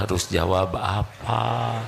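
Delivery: usual speaking voice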